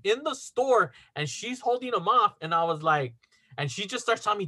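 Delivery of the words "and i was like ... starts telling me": ordinary talk, nothing marked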